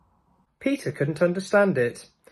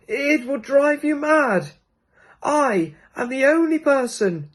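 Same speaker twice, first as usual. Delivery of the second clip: put-on ancient child; 11 semitones higher than usual. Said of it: "said the ancient child".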